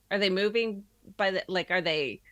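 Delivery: ordinary talk, nothing marked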